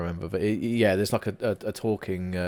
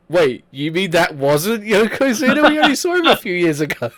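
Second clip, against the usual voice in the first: confused; 7 semitones higher, 6 dB louder than usual. Pretend. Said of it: "they'll be like".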